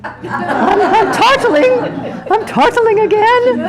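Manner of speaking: high-pitched, helpless laughing admission. Acted. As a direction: squeal it through laughter. Laughing while acting out forgetting.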